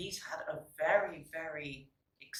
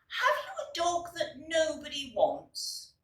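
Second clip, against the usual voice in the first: put-on high, pleading child voice; lightly voiced children, hopeful; children asking; 9 semitones higher than usual. Children ask the man at the dogs' home, pleading. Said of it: "they asked the man".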